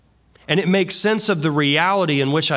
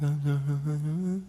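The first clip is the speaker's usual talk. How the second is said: much softer, barely there; a child in trouble going small; a guilty child trailing off; child's quiet voice; 9 dB quieter than usual. Retold or imitated. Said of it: "their voice gets real quiet".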